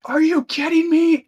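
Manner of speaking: high, loud outraged yell from the crowd; angry shout. Acted. Rioter yelling at the herald over the gate.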